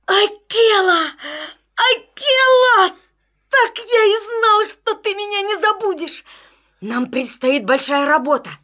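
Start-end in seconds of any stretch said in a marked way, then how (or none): 0.1-5.6 joyful call. Acted. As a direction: call out an old friend's name gladly. Joyful cry to old wolf. (радостный зов)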